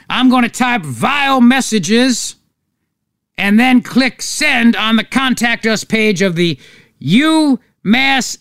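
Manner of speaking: high, drawn-out hammed-up Southern drawl; bad Southern accent. Host acting out a fake hate-mail confession, hammed-up Southern accent. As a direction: put on a slow, high Southern bigot's accent. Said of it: "Southern accents, which is why that accent".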